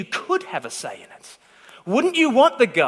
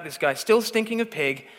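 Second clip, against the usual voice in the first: ordinary talk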